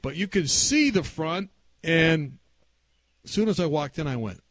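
ordinary talk

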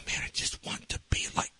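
soft, soothing whisper. Retold or imitated. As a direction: drop suddenly into a whisper over the yelling. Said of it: "I started whispering".

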